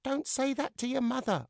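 high, urgent puppet plea; begging. Acted. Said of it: "I begged".

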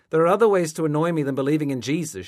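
loud, fast, high-pitched scolding; annoyed rebuke. A narrator's reading. Mother to son drawing a crown of thorns, annoyed rebuke. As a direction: fire it off in annoyance.

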